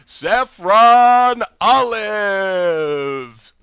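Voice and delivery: long drawn-out booming announcer call; ring announcer voice. Shown as a demonstration. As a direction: stretch every syllable like a wrestling ring intro. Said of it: "announcer's voice".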